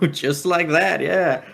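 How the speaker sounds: mock him with a grin; Vamp taunting. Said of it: "he goes, laughs".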